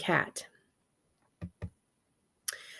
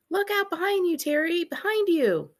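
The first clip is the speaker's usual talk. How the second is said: high, urgent warning; hammed-up shark alarm; mock alarm; 9 semitones higher than usual. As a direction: shout the warning in mock panic. Warning Terry about sharks.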